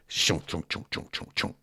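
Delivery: low, breathy imitation of a truck engine not turning over; engine failing to start. Telling friends how the truck would not start, mimicking the engine sputter. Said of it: "you hear".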